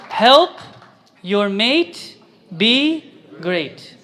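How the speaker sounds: very slow, stretched words, pausing between them; word-by-word prompt. Shown as a demonstration. Preacher reciting point four with the audience, slow word-by-word prompt. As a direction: lead the room through it one word at a time.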